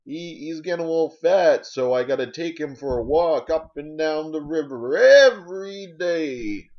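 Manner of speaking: high, loud old-timer impression; hammed-up elderly voice, stretched at the end; old person. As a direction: proudly holler it like an elderly dog owner. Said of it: "And they'll say".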